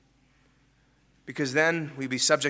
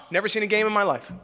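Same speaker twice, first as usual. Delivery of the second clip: quick sheepish admission; 2 semitones higher, faster than usual. Acted. Skit answer, quick admission.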